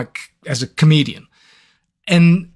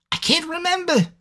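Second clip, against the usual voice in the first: much higher, whiny mock despair; mock whine; 13 semitones higher, 4 dB quieter than usual. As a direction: squeak it out in fake frustration. Forgetting mid-sentence.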